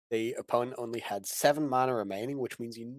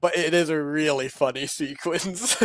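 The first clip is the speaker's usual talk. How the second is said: laughing; 4 semitones higher, 5 dB louder than usual. Real, not acted. Laughing at a funny sequence.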